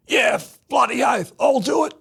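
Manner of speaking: Smithy's delighted yes. Retold or imitated. Smithy accepting five eighth, loud, high, hammed-up excited shout. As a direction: belt it out like an overjoyed bloke.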